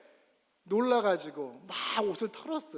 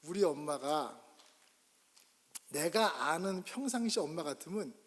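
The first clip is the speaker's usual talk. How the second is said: ordinary delivery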